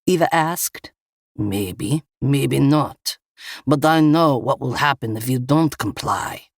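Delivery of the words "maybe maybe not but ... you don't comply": Russian gunman, opening low then firmer; thick Russian accent. A narrator's reading. Russian gunman threatens Eva over compliance, thick Russian accent. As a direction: toy with them in a Russian accent. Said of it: "a man with a thick Russian accent".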